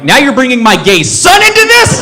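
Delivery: high, mock-indignant shout; outraged outburst. Dad defending his son from the doorman, high outraged yell. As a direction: blow up in hammed-up outrage. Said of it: "It's going off in my head".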